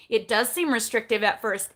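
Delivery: usual speaking voice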